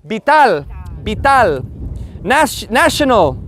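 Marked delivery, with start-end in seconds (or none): 0.0-1.7 Spanish vital as "bee-tal". Shown as a demonstration. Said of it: "the I is the dolphin".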